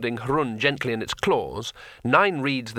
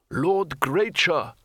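very slow, higher, awed address; old man's whisper; 4 semitones higher, much slower than usual. A narrator's reading. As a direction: call the name in drawn-out wonder. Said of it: "whispered the old Lawmaster".

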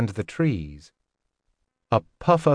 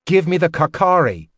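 higher-pitched, insistent order; angry demand; 6 semitones higher, 4 dB louder than usual. Acted. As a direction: demand it back, heated. Angry demand to laughing master.

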